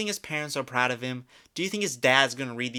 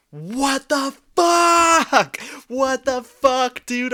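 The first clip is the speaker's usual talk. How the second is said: drawn-out, much higher and louder disbelief; stunned outburst; 10 semitones higher, 8 dB louder, much slower than usual. Real, not acted. Reacting to the fan's spending, shocked loud disbelief. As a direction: blurt it out in total shock.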